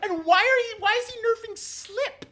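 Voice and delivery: high, indignant outburst. Real, not acted. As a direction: demand it in disbelief. Indignant outburst about the spell.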